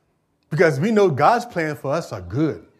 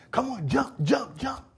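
put-on higher, softly coaxing a child to jump; 9 semitones higher, 4 dB quieter, slower than usual. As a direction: gently urge the little one off the counter. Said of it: "And I say".

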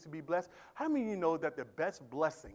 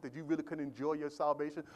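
ordinary talk

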